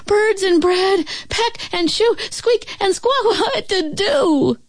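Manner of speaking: very high, flustered outpouring; frantic tumble of words. A narrator's reading. Sophie pouring out her bird problem, high and flustered. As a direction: blurt it out high and overwhelmed. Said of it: "words came out in a tumble".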